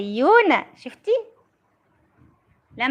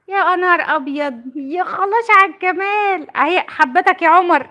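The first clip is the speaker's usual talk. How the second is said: high, slow, louder swooning praise; gushing delight; 5 semitones higher, 4 dB louder than usual. Real, not acted. Mother swooning as her daughter sends hearts, gushing high delight. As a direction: melt over how sweet it is.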